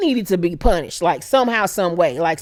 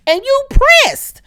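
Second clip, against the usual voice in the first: mocking; 6 dB louder, slower than usual. Real, not acted. Mocking the jealous queen.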